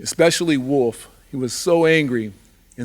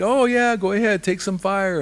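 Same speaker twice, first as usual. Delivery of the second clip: nervous Rattlesnake; 7 semitones higher than usual. Acted. Rattlesnake answers Salmon nervously.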